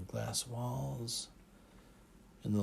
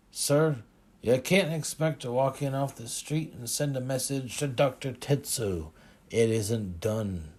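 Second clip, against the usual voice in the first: snapping; 3 semitones higher, 8 dB louder than usual. A narrator's reading. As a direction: snap it out loudly as an officious guard. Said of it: "The guard snapped".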